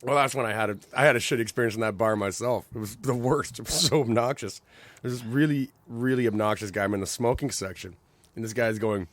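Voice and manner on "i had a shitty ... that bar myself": normal talking voice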